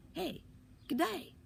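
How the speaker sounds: put-on high squeaky kid calling from up a tree; high-pitched squeaky holler; squeaky yell. A narrator's reading. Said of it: "A squeaky voice from somewhere in the sky yelled".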